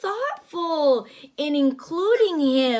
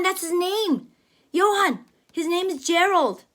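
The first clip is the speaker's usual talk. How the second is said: usual speaking voice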